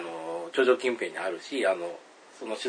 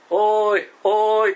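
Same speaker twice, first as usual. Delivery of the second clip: loud drawn-out call; eerie voice calling from the dark; 12 semitones higher, 10 dB louder, much slower than usual. Retold or imitated. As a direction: unseen caller on the mountain, loud.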